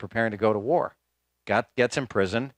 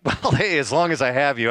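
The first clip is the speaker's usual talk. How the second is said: eager; 4 semitones higher, 5 dB louder than usual. Retold or imitated. Retold asking Kofi Annan eagerly.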